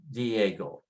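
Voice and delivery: "Diego" as DYEH-go, weight on the e; "Diego" with a stressed E; strong-vowel stress. Shown as a demonstration. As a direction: lean on the e in "Diego", falling after. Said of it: "put the stress on the strong vowel".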